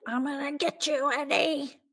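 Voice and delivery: high, held-back imitation of a distant yell; mock vengeful cry. Strangler vowing revenge on his partner, high mock cry. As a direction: call it out high without real volume.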